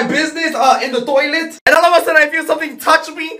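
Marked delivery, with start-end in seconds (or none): none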